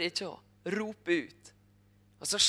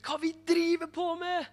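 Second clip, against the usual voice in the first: high, raised imagined outcry; wanting to roar; 12 semitones higher than usual. Retold or imitated. Nikodemus to the crowd at the cross, imagined outcry. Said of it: "har lyst til å brøle ut".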